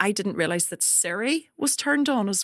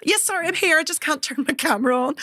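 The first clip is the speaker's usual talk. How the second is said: higher-pitched, hurried-sounding excuse; acted fluster, pitched up; retold flustered apology; 7 semitones higher than usual. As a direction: re-enact an embarrassed reply to the therapist. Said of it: "And I was like".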